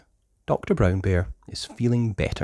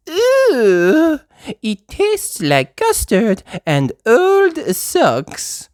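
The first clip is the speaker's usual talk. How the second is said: very high, slow disgusted wail; hammed-up yucky cry; Madame Gazelle crying out; 7 dB louder, much slower than usual. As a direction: wail about the medicine. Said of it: "cries Madame Gazelle".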